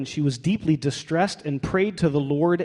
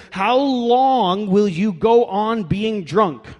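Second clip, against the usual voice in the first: loud, slow, raised-pitch scolding; drawn-out, theatrical reproach; mock stern rebuke; 5 semitones higher, 5 dB louder, much slower than usual. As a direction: scold her as an indignant priest. Reads out the priest's harsh rebuke.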